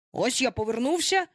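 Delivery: mild rising irritation; starting to get angry. A narrator's reading. Peter faces his silent gang, starting to get cross. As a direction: announce it with a touch of annoyance. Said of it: "уже починаючи сердитись".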